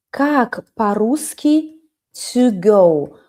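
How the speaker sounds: ordinary talk, nothing marked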